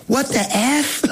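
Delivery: high-pitched imitation of a stunned friend; shocked disbelief. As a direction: blurt it as the astonished friend. Friend's stunned reaction, retold.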